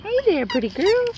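cooing at puppy. Real, not acted. Cooing at puppies.

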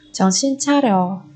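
ordinary talk

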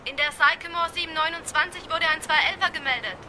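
dispatcher over a radio line. Acted. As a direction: report the call over the radio. Dispatcher reports incident.

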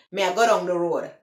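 quick Jamaican patois, words run together. Shown as a demonstration. Vlogger contrasting it with English, quick Jamaican patois. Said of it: "is the broken English, patois".

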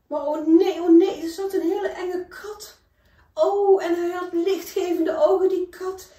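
a scary cat with glowing eyes; frightened. Acted. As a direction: gasp it out high and loud in fear.

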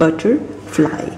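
butterfly drawn out very slowly; slow demo. Shown as a demonstration. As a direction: stretch butterfly as a model. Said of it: "जैसे मैं आपको book में दिखाई थी". (धीरे से खींचकर बोलना)

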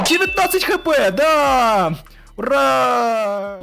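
high, loud victory shout; triumphant cheer. Real, not acted. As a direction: cheer the win. Celebrating boss win.

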